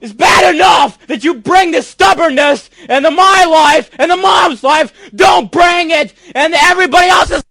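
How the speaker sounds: high, raging shout at his father; furious shouting. Real, not acted. Son screaming at his dad on the live show, furious. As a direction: unload on him, screaming.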